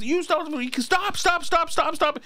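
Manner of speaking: imitated enraged waiter, pitched high; high, urgent mock yelling without real volume; mock angry yell. Waiter yelling after a fleeing diner, high mock-angry cries. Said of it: "this guy was really loud".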